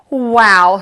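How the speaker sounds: loud, wide-eyed wow; awed wow. Acted. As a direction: gasp out your amazement. Lily amazed by teacher.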